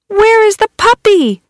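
high loud worried call; worried cry. Acted. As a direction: call out anxiously. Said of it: "cried the kids".

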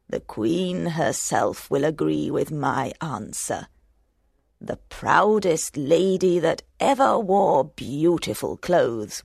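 low, soft crone voice, half whispered; old hag. Acted. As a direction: murmur it slyly like an old crone. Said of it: "said the hag".

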